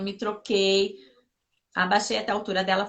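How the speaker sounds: ordinary delivery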